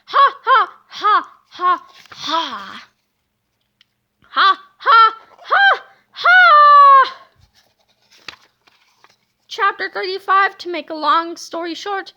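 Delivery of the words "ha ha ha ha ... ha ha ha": villain's booming, rising cackle; evil laughter. A narrator's reading. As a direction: laugh like a triumphant mad villain. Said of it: "laughed and laughed".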